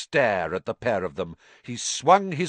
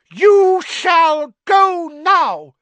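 very high, loud, very slow command; fierce elf; 12 dB louder, much slower than usual. Acted. House elf banishing his old master: fierce. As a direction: order him away fiercely. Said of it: "he said fiercely".

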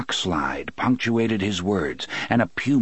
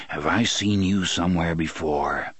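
ordinary delivery

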